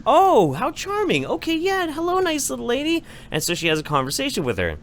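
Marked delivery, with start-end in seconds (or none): 0.1-3.0 high put-on voice of a charmed girlfriend; imitated girlfriend. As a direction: mimic her polite delight, pitched way up. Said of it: "this girl's like".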